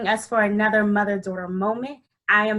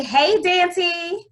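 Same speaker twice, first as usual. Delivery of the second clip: audience call-out; 7 semitones higher than usual. Shown as a demonstration. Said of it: "Everybody say".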